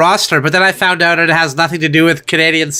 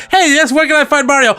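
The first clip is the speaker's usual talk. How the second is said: higher-pitched eager tourist question; tourist asking; 6 semitones higher, faster than usual. Acted. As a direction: play a tourist asking around for someone. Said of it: "tourists coming around saying".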